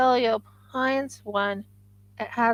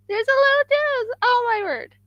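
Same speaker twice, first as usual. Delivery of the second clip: much higher thrilled voice; excited high pitch; 14 semitones higher, 4 dB louder than usual. Real, not acted. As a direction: gush with delight, pitch climbing. Thrilled crafter showing her work.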